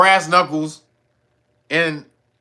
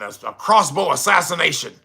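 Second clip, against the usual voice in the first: ordinary delivery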